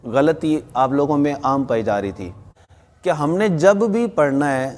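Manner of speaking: ordinary delivery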